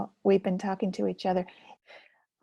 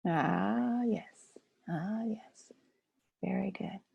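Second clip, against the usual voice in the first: whisper; 6 dB quieter, much slower than usual. Real, not acted. Soft approving aside.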